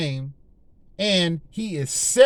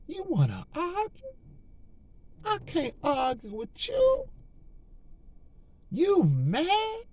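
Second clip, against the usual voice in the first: high, mocking singsong, softer; taunting the devil; 11 semitones higher, 6 dB quieter, slower than usual. Believer brushing off Satan's arguments, high mocking taunt. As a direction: tease Satan like he can't touch you. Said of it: "Slap that plies on him".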